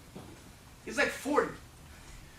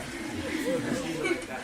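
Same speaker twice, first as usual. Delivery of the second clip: laughing; much slower than usual. Real, not acted. Laughing aside to someone.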